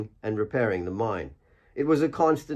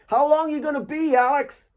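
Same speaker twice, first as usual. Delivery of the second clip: high, louder call across the field; 3 dB louder than usual. Acted. Asif calls up the hill to Alex, high and loud. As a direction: shout up the hill to a teammate.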